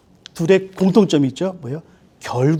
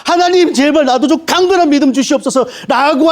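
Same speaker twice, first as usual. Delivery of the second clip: higher, louder crying out to God; 8 semitones higher, 4 dB louder than usual. Retold or imitated. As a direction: cry out in anguished pleading. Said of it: "부르짖음".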